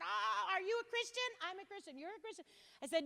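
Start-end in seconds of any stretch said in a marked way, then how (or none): 0.0-1.3 high, far-off put-on voice; mock eager petitioner. Petitioner voiced about church. 1.4-2.4 high and softer, playful; mock soft exchange. Speaker voicing the petitioner's surprise, high and soft.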